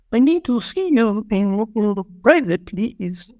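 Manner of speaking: a teacher's polite request in a put-on Burmese accent; Rena's Burmese accent. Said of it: "but with a very heavy accent".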